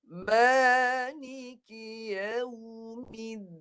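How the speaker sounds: Maliki yaumiddin drawn out very slowly, nun hummed in the nose; slow recitation. Shown as a demonstration. Quran teacher reading slowly to show nun. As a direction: stretch every syllable of Maliki yaumiddin slowly, high. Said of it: "Ini baca lambat".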